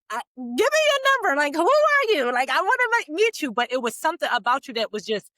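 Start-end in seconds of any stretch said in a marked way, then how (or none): 0.1-3.5 eager excited quote. Retold or imitated. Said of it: "a great person is just like".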